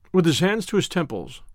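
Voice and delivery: plain reading voice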